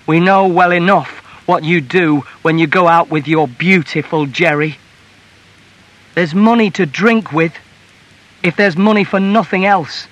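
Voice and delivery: loud, high scolding with bitter sarcasm; indignant wife in a row; angry accusation. A narrator's reading. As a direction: scold a drinking husband at full voice. Wife continues the quarrel.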